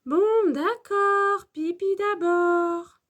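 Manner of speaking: high, slow, drawn-out concession; maîtresse qui cède. A narrator's reading. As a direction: give in to the children, stretching each phrase. Teacher gives in to request. (accord résigné, voix aiguë et traînante)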